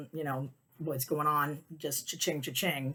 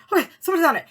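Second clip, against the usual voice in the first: retold flustered excitement; 11 semitones higher, 8 dB louder, much faster than usual. Reacting, starting to panic.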